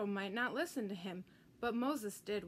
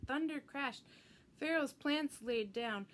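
plain reading voice

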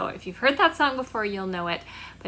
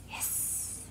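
long unvoiced hiss; 17 dB quieter than usual. Shown as a demonstration. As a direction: hiss like a snake. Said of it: "They go hiss".

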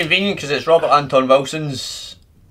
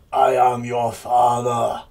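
slow, lightly voiced Darth Vader; a hint of a menacing masked villain; Darth Vader impression; slower than usual. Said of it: "a bit of Darth".

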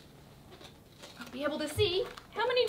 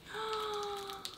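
excited gasp; 9 semitones higher, 9 dB quieter than usual. Real, not acted. Opening next door.

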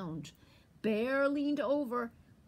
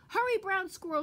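high, loud urging call; urgent grandmother; 9 semitones higher, 5 dB louder, faster than usual. A narrator's reading. Grandmother calls squirrel from the door, urgent high call. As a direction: call him home in a high voice.

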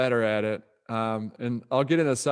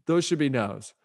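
usual speaking voice

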